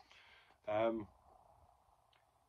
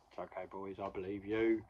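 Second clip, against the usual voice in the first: low, soft imitation of a kindly teacher; hushed, understated comforting; quiet reassurance; 4 semitones lower, 8 dB quieter than usual. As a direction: reassure them softly and low.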